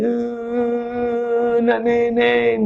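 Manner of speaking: imitated child's drawn-out whine; mock toddler cry, stretched out. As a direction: wail softly like a small child begging. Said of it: "Mana-mana anak nangis".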